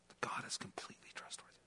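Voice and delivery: breathy whisper of certainty; whispered conviction. Acted. Preacher telling the church what believers' voices reveal, whispered. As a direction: let it fall to a bare whisper. Hushed climax of the sermon point.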